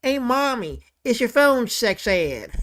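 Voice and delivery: slurred, slow cartoon son; kid calling Mommy. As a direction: play the son calling out to his mom. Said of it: "the son goes".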